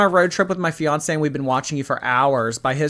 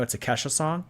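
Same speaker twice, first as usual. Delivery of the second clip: normal talking voice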